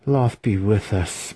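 slow exasperated groan; groaning aside. A narrator's reading. Student despairs of his master's spell, a mild slow groan. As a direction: mildly groan it out, drawn out. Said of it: "Massage groaned under his breath".

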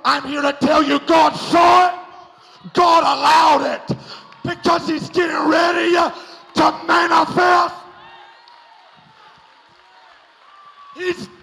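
loud, rising preacher's shout; preaching at full force. Acted.

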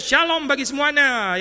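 high, drawn-out mock greeting; slow, high parody of a herald; mock grand announcement. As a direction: hail everyone with exaggerated cheer. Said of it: "datang terus mengumumkan".

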